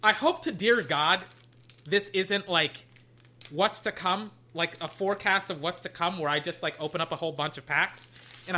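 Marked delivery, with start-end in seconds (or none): none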